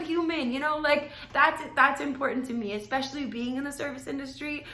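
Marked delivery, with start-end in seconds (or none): none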